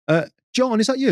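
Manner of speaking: overly friendly. Retold or imitated. Phoning the rude customer.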